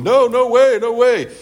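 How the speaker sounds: high, loud, hammed-up disciples crying no way; mock protest. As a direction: squeal it like the disciples refusing. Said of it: "His disciples are going".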